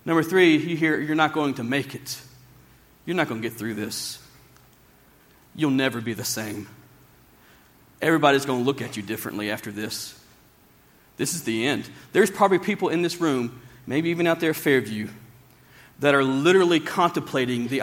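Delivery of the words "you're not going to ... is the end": low, hushed voice of despair; softly and low, let each line sink; discouraging inner voice. Acted. Preacher voices the lie people hear, low and discouraging.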